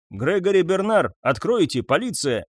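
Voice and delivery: громко, резко, требуя открыть; полиция барабанит в дверь; требовательный окрик. A narrator's reading. (полицейский за дверью громко требует открыть)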